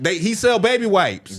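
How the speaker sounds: high, slow mock crowd chant; mock crowd chorus; crowd callback. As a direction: call it back like a crowd.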